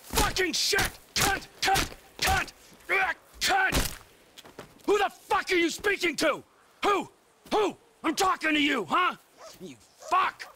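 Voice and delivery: furious yelling. Acted. Rage outburst.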